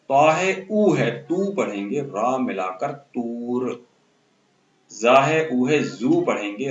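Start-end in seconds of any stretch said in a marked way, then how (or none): 2.0-3.9 slow, drawn-out रा before तूर; slow blending. Shown as a demonstration. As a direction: stretch रा, then say तूर. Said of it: "ऊ पढ़ा जाता है".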